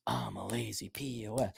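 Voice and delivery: very soft, low repeat; meek echo. Acted. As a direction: mumble it back quietly and low.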